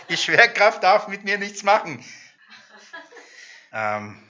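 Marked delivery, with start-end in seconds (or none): 0.1-2.0 lachend über den eigenen Übereifer; lachend gespielt. Retold or imitated. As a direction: sich selbst auslachend, höher. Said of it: "den Psalm und denk". (lachend nachgeahmter Übereifer)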